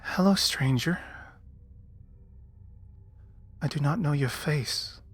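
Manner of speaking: pixie. Acted. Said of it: "You hear a tiny voice inside".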